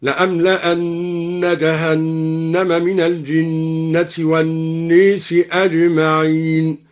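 slow chant, والناس said "wan-neesi"; imala on الناس. Shown as a demonstration. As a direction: recite slowly, bending the long a of والناس to ay. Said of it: "وأمال الناس". (تلاوة مرتلة بإمالة ألف والناس)

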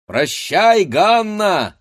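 high, loud, teasing shout; loud cry. A narrator's reading. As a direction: call out a mocking farewell. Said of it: "закричал в это время один из парубков".

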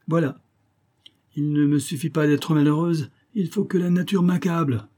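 exasperated outburst, pitched high. A narrator's reading. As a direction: burst out in high-pitched indignation. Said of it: "Ariane éclata".

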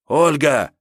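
loud, high shout of her name; calling out. A narrator's reading. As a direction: cry out to rouse her. Calling the motionless woman again.

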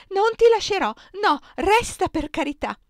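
very high, loud imploring cry; desperate begging. A narrator's reading. Superiora begging her not to leave, desperate begging. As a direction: refuse to let go, pleading wildly.

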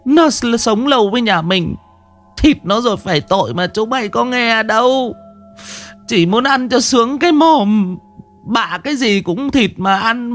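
put-on high, tearful old woman's lament; old woman whimpering. Old woman begging the master and scolding her children, high tearful. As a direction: wail and scold through tears. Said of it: "Bà cụ Tứ mếu máo".

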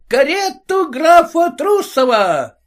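high, slow doorman's call; announcing a carriage, high and drawn out. Acted. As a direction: bellow for the count's carriage. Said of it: "Дозвольте сейчас крикнуть".

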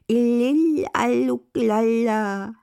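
high, loud babbling infant; baby babble. Acted. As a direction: babble like a baby learning words. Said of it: "das Baby plapperte ihr nach".

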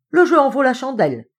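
high, loud, determined declaration; peremptory. A narrator's reading. Padpinton rallying heroically before Hopkins, peremptory. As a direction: declare it with newfound resolve. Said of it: "son ton péremptoire".

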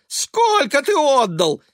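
slow, high, loud horror; shrieking. A narrator's reading. Mother questioning her son, shrieking. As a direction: squeal the question drawn out. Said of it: "взвизгнула Элиза".

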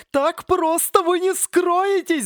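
high, slow, loud tree scream; screaming palm. Acted. Crazed palm screaming after the fleeing students. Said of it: "заорала психованная пальма".

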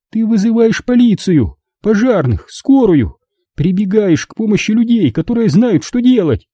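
pleading mother. Acted. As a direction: plead with him as his mother. Mother arguing with son. (мать умоляет сына, высоким голосом)

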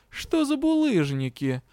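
a puzzled, drawn-out query; surprised drawl. A narrator's reading. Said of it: "удивлённо протянул Дроу". (удивлённо, нараспев)

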